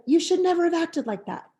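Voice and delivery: a teacher scolding a pupil who misbehaved. Shown as a demonstration. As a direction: tell the child off sharply. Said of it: "instead of".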